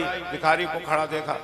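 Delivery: ordinary delivery, nothing marked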